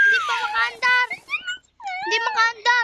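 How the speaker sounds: high desperate shout; panicked shouting. Real, not acted. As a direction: yell in real panic. Car won't start, monster coming.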